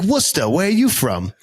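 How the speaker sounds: proud local Boston voice; Boston accent. Retold or imitated. Telling driver I'm from Worcester, Boston accent. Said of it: "having a Boston moment".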